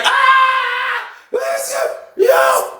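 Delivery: very high, strained hammed-up shout; very high, mock yell across the room; high mock call-out. Dude calling out in the pod.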